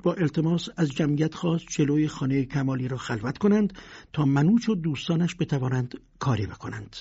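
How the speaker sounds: plain reading voice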